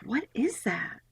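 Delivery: whispered, unvoiced what is that. Peering at the blurry spirit, a puzzled whisper. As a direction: breathe the question out unvoiced. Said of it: "I was like".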